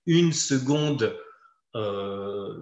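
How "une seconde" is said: "une seconde" slowly, as "segonde" with clear D; final D sounded. Shown as a demonstration. Teacher showing consonant sound, "une seconde" slow with final D. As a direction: draw out "une seconde" and land on the D. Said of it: "le son qui serait produit".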